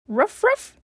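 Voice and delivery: high, loud little yaps; puppy barking happily at her mom; puppy barks. Acted. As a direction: bark like a sweet puppy. Puppy licks mom's cheek.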